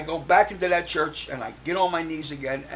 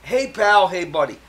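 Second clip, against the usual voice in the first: louder chummy "Hey, pal"; imitating cellmate's greeting; 3 semitones higher, 9 dB louder than usual. Cellmate Maury greeting Mike, loud chummy call. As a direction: hail him like an old pal. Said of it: "That's exactly what he said to me".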